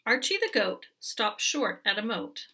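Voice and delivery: ordinary delivery, nothing marked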